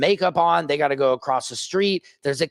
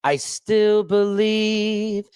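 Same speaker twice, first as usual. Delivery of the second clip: stretched very slowly, higher; drawn out; 5 semitones higher, much slower than usual. Shown as a demonstration. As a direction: linger on each word.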